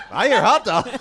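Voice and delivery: loud, garbled hot dog mimicry; Charles Barkley. Panelist retelling a favourite clip, imitated voice. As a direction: mimic a blurting sports pundit. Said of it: "Charles Barkley".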